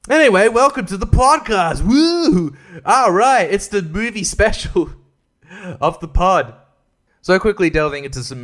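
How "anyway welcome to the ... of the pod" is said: loud, high whooping welcome; over-excited host opening, pitched up; hyped show intro. Pretend. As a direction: kick off the show with a big woo. Announcing the podcast's movie special.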